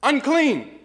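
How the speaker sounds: high, loud cry of "Unclean!"; leper's cry. Acted. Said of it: "they had to scream out in loud voices".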